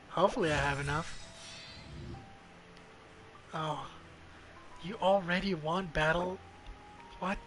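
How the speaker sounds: usual speaking voice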